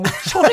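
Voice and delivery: sung-out jingle of a dropped coin; imitated coin clink. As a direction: voice a coin landing in a can. (硬貨が落ちる音の口まね)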